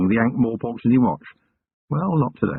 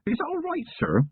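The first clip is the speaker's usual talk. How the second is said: put-on a touch anxious, higher young voice; nervous young constable checking; 4 dB quieter than usual. Young constable anxiously asking his commander. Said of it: "Feeney said anxiously".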